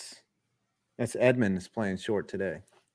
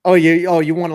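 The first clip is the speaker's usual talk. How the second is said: excited; 5 semitones higher, 9 dB louder, faster than usual. Real, not acted. Excited burst before impression.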